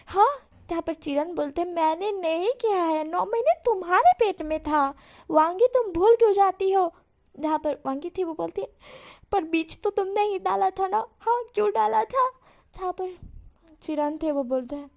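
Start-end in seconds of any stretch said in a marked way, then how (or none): 1.7-6.9 heated argument. Acted. Husband snapping back at his wife, rising to a shout. 9.3-12.3 higher, louder comeback; bickering retort. Acted. Wife retorting to husband in a petty fight, raised.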